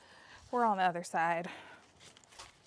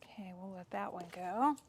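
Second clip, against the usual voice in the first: ordinary talk, nothing marked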